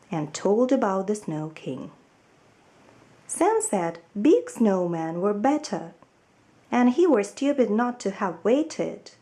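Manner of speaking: ordinary delivery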